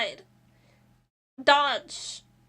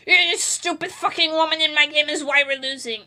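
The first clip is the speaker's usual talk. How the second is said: imitating a raging teammate, loud; a hammed-up toxic insult; mock angry gamer; 6 dB louder than usual. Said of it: "they're like".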